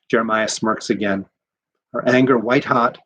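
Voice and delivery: ordinary delivery, nothing marked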